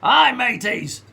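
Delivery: slow, light pirate voice. Pretend. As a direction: ham up a pirate greeting. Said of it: "I guess I'm an English pirate".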